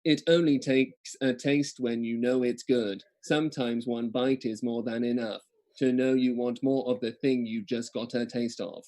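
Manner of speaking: slow, even robot voice. Shown as a demonstration. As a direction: read each word like a robot. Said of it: "I'm just gonna do robot voice".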